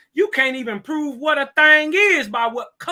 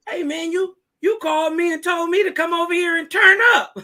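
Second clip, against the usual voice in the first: retold shout; 7 semitones higher, 5 dB louder than usual. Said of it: "he said, uh".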